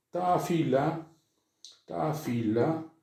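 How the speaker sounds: slow "Ta fila", stress on ta; separated lambdas. Shown as a demonstration. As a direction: dictate ta fila slowly to the class. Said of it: "se pronuncian como separadas". (dictado lento de "ta fila")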